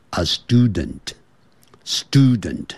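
"student" slowly, with a full "dent" ending; very slow, dutifully complete "student"; slow careful reading. Shown as a demonstration. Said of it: "念慢的时候， 我也是会乖乖的念成".

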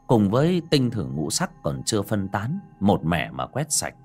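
ordinary delivery

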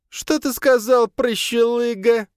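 loud, high, slow yell; drunken shout. A narrator's reading. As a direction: bellow it across the gate. Drunk count shouting back. (пьяный крик)

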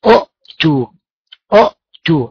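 أؤتوا with a glottal stop after the first u, u'-tu; original form with two hamzas. Shown as a demonstration. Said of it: "أصل أوتوا".

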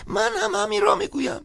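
put-on very high old man crying out; old priest shouting he agrees; shouting agreement. A narrator's reading. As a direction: exclaim in eager agreement. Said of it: "فریاد زد".

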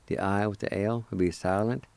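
ordinary talk, nothing marked

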